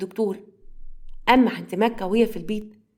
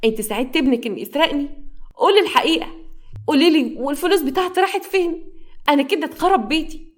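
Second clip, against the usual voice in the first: high-pitched, louder acted scolding; angry yelling; 6 semitones higher, 4 dB louder than usual. A narrator's reading. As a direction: demand answers from your mother, voice climbing. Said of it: "وقعدت تزعق لأمها". (زعيق غاضب بصوت عالي)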